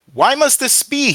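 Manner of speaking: high, loud anguished cry. A narrator's reading. Burning captive questioning God, crying out. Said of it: "he cried out".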